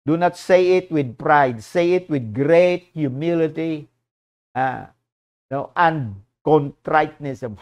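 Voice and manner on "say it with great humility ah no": ordinary delivery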